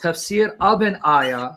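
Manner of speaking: slow, with weight on "of"; stress on OF. Shown as a demonstration. Debater pinning down the other's wording, stress on "of". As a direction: draw out "tafsir of an ayah", leaning on "of". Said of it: "but then you said ayah".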